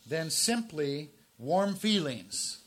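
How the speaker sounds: slow, loud, deliberate reading of the quote; emphatic quote reading. Shown as a demonstration. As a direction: lean on every word of the quote. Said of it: "I, I like this quote, so I'm just gonna read it".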